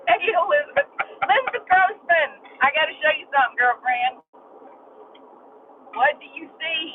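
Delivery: ordinary talk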